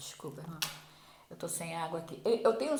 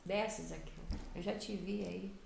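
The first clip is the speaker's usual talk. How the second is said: soft, low-voiced call to a man in a tree; quietly and knowingly summon him down; Jesus; 5 semitones lower, 8 dB quieter than usual. Jesus calls Zacchaeus down from the tree, quietly. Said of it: "ele olhou pra cima".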